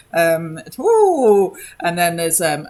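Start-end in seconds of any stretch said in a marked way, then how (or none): 0.8-1.6 high mock ooh from the guards; a jeering little ooh. Guards sniggering at the puppet show, high mocking ooh. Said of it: "the guards are sort of".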